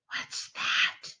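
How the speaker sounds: hushed, breathy little question; whisper. Acted. Small squirrel asking her dad in the dark, whispered. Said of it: "she whispered to her dad".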